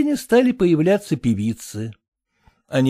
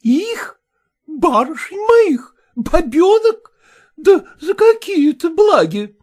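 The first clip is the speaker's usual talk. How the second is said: indignant exclamations, high and loud; exclaiming; 14 semitones higher, 6 dB louder, slower than usual. A narrator's reading. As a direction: cry out in outraged disbelief. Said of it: "воскликнула Колкина".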